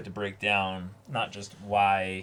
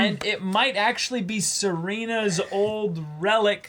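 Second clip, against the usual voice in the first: excited; 8 semitones higher than usual. Real, not acted. Reacting to episode reveal.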